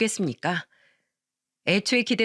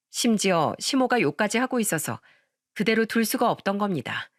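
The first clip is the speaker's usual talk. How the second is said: usual speaking voice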